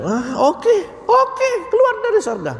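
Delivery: high, mocking, careless "oke, oke"; dismissive Iblis. Preacher voices Iblis shrugging off expulsion, high and mocking. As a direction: toss it off like it doesn't matter.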